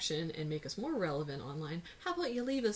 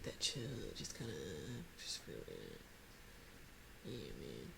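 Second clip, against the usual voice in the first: hushed, low, laid-back painter voice; bad Bob Ross; 14 dB quieter than usual. Comparing co-host to a painter, badly imitated chill voice. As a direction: trail down into a soft, chilled-out murmur. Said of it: "That was a very bad Bob Ross".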